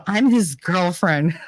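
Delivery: slow, smug "I'm his girlfriend" in a put-on voice; mocking self-important. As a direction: parody her self-importance, drawing it out. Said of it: "I love the, the whole self-imp".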